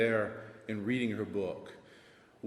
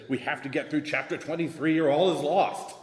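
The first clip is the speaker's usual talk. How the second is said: mock panic; 5 semitones higher, 6 dB louder than usual. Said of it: "as you said".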